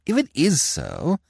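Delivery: ordinary delivery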